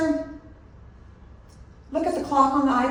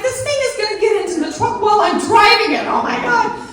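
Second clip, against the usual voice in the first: much higher, louder panic; panicked thought; 12 semitones higher, 8 dB louder than usual. Retold or imitated. Fearing the rat gets into the truck, high panic. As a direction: blurt your fear in a rush. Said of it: "I'm like".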